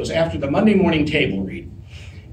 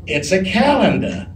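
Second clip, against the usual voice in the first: put-on Southern belle accent, higher pitch; Blanche Devereaux; 4 semitones higher than usual. Stand-in answers co-star in rehearsal, in a Southern belle accent. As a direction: play it with a put-on Southern accent, dropping the final r. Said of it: "you don't do the accent".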